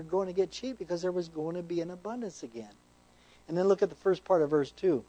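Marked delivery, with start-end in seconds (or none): none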